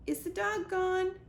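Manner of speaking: nervous squirrel. A narrator's reading. Scared squirrel asks.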